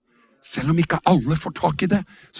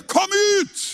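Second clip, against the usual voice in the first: much higher ringing cry; commanding shout. Acted. Pastor commanding darkness to leave, ringing shout. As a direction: call it out like a command to the dead. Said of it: "så roper vi bare".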